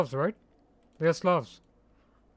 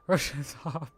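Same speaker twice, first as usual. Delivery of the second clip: laughing; 6 dB quieter than usual. Real, not acted. Cracking up while reading chat.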